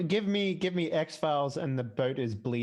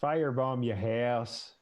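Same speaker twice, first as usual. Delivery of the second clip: slow, lower Irish accent; 4 semitones lower, slower than usual. Shown as a demonstration. Showing friends the line that works, Irish accent. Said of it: "it puts me in the Irish accent".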